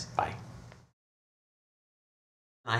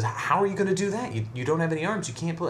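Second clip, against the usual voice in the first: higher-pitched disbelief; incredulous priest; 6 semitones higher than usual. Acted. Priest doubting an armless hunchback can ring the bell, incredulous.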